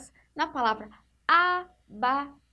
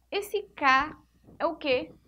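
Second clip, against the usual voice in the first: ordinary delivery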